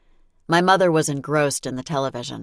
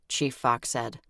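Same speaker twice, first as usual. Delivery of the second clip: ordinary delivery, nothing marked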